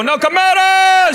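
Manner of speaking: high, slow, drawn-out announcer call; mock band announcer. Band at the club announcing itself, high and slow, drawn out. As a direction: sing out the band name like a hyped MC. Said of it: "And they go".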